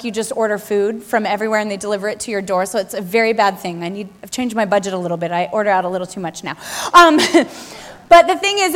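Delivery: ordinary talk, nothing marked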